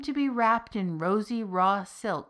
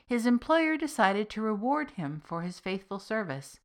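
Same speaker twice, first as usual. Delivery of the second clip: usual speaking voice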